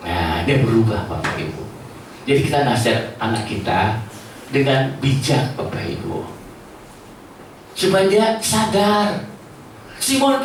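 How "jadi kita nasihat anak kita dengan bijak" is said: ordinary talk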